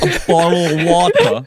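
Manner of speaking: hammed-up British "bottle of water"; British accent. Shown as a demonstration. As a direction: drop the t in water, British style. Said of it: "convincing British accent".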